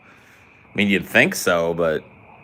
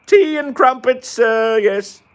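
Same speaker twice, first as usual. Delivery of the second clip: mock British accent; 6 dB louder, slower than usual. Gamer mocking Brits with tea and crumpets, in a hammed-up British accent. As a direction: ham up the tea-and-crumpets Brit. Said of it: "this British thing is hard".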